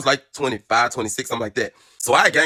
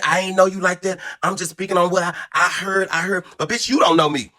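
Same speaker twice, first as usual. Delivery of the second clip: high, heated retold rant; mock fury at her; pitched-up furious retelling; heated telling off; 8 semitones higher than usual. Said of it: "I said".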